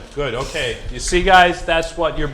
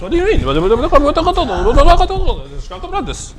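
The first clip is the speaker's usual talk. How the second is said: mock bewildered; 10 semitones higher, 9 dB louder, faster than usual. Mock confusion over pronunciation.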